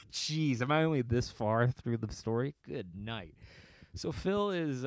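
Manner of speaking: usual speaking voice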